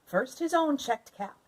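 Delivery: ordinary delivery, nothing marked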